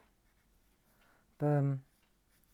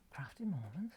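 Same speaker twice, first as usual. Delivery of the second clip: whispered to self in disbelief; unvoiced puzzled murmur; 3 semitones lower, 12 dB quieter than usual. Real, not acted. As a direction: breathe it out, baffled. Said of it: "whispered to self".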